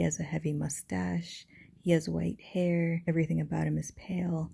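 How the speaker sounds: a fast, hushed whisper. Acted. Reading Dracula's description to viewers, whispered. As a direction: breathe it out like a secret.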